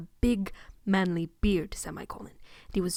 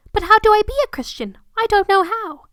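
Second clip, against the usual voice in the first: put-on eager child; 12 semitones higher, 10 dB louder than usual. Harry child voice, questioning.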